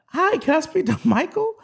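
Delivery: very high, sweet-phone-girl impression; high-pitched mock caller voice; woman caller.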